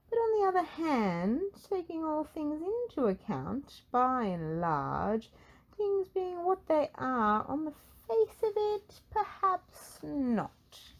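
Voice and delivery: high, rambling wizard voice, slowing at the end; fussy old wizard dithering; wordy wizard. Acted.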